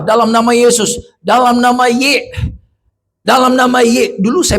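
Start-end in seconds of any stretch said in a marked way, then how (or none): 1.2-4.1 higher, slowed words cut off mid-name; unable to finish. Acted. Possessed person tries repeating the prayer, choking off the name. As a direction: act stuck on "Y", breaking off before the name. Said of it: "tidak bisa berdoa".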